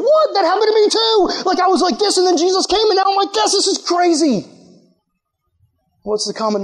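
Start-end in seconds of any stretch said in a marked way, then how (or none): 0.0-4.5 amazed stranger. Retold or imitated. Preacher acting out a stranger who shares his story, high and amazed. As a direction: squeal it like you can't believe they went through it too. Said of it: "this person over here is going".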